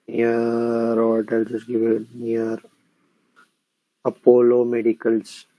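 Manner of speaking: ordinary delivery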